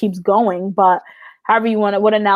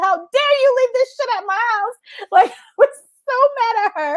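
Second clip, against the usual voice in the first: mock mad, laughing. Scolding her friend, laughing.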